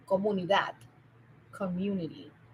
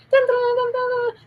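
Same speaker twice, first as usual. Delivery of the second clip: high sung dun dun sting; news sting hum; 14 semitones higher, 6 dB louder than usual. Retold or imitated. Imitating the 1010 WINS sting, high sung.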